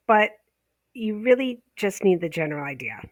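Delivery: normal talking voice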